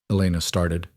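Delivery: ordinary delivery, nothing marked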